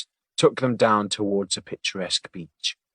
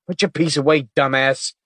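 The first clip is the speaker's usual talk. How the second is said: louder, higher rebuke; shouted; 5 semitones higher, 5 dB louder than usual. Acted. Weiss to gun-waving partner, shouted rebuke. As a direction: bark it at your partner. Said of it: "shouted Weiss".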